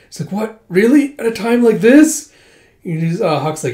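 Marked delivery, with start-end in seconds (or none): 0.3-2.3 much higher and louder, shocked disbelief; dismayed disbelief. Retold or imitated. Princess dismayed at her period, high and loud. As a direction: wail at the bad timing.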